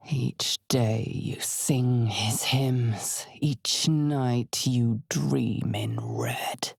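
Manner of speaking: slow, breathless whispered chant; eerie whisper. Acted. Narrator voicing a sinister cult chant, slow eerie whisper. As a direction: whisper it slowly like a creeping curse.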